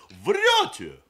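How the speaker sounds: very high, loud accusing cry; sharp interruption. A narrator's reading. Grandmother stopping the groom's singing, sharply. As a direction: cut the singer off with a shout. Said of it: "резко оборвала его бабушка".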